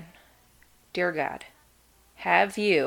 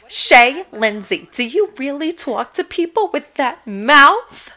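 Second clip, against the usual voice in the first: higher, louder, slow scolding mom; 5 semitones higher, 5 dB louder, slower than usual. Retold or imitated. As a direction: nag like a shocked mother. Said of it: "Which she yells at me".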